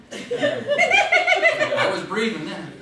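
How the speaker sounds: laughing punchline. Real, not acted. Punchline after doctor smacks.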